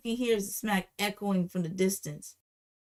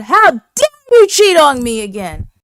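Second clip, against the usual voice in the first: high, very loud mock outrage; full-volume acted-out fury; outraged shout; 6 semitones higher, 18 dB louder than usual. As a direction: yell the accusation, imitating a furious woman. Same accusation re-enacted at full volume.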